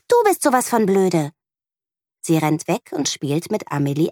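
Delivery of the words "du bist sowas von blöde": put-on a snappy, higher child's retort; hissing back. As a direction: hurl the insult back, stressing du. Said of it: "faucht Lea zurück". (schnippisch zurückgefaucht, Betonung auf du)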